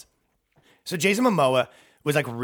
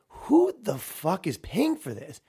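slow, higher, baffled disbelief; baffled outrage; 6 semitones higher, slower than usual. Retold or imitated. Reacting to a subway ad, slow incredulous outburst. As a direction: stretch it out in disbelief. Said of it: "I'm just like".

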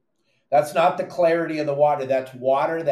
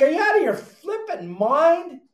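incredulous; 14 semitones higher, 4 dB louder than usual. Retold or imitated. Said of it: "I'm like".

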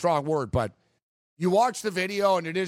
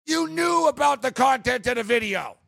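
indignant; 6 semitones higher than usual. Real, not acted. Host blasting the Chiefs' hypocrisy, heated rant. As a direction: keep hammering them.

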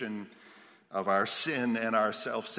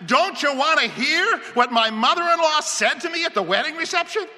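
high, loud, urgent call; calling after him; 7 dB louder than usual. A narrator's reading. Homeowner calls after the fleeing researcher, insistent. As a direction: holler after someone who is leaving. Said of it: "as you call after him".

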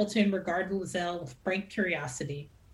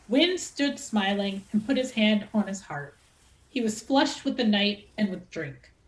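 ordinary delivery

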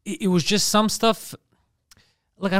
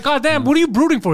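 higher, louder, fast exasperated rant; angry Alfred yelling; 7 semitones higher, 5 dB louder than usual. Retold or imitated. Butler yelling at brooding Batman, higher and louder. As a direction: blow up at him like a fed-up butler.

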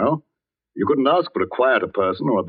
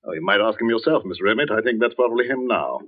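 ordinary talk

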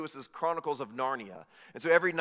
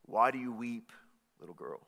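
soft, lower, halting question; lightly voiced lion, dropping to a hush; gentle lion; 6 semitones lower, 4 dB quieter than usual. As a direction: speak tenderly to a crying child. Voices Aslan to crying girl.

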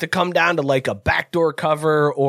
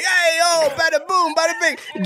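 Italian wise guy; 11 semitones higher than usual. Retold or imitated. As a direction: ham up the Italian tough guy. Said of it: "Scott and I are like".

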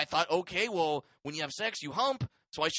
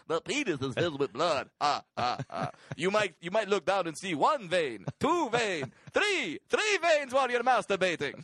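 put-on vampire count accent, building louder; Transylvanian accent; 5 semitones higher, 4 dB louder than usual. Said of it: "a Transylvanian voice".

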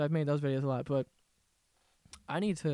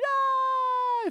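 shrill yelp. Retold or imitated. Mocking YouTuber outburst.

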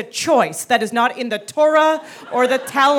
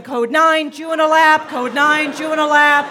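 raised, loud radio alert; higher, louder mock emergency code; elf on walkie-talkie; 5 semitones higher, 5 dB louder than usual. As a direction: imitate an urgent call over a walkie-talkie. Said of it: "an elf in a walkie-talkie".

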